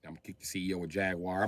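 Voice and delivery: ordinary talk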